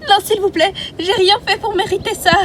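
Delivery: high begging voice; beg, scared; high scared plea; frightened pleading. Acted. Captive begs again.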